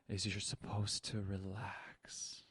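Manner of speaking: soft whispered instruction to relax. Shown as a demonstration. Coaching a surfer, whispering to relax. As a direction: breathe it out in a hush. Soothing advice mid-story.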